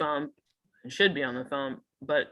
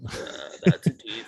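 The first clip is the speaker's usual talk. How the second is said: very deep, breathy bass register; low morning voice; 8 semitones lower than usual. Retold or imitated. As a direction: sink as low as the voice goes. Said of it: "low voiced Amy voice".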